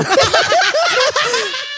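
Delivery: very high burst of laughter; helpless squealing laugh; shrieking laughter. Real, not acted. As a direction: crack up in a long squeal. Cracks up at own trauma joke.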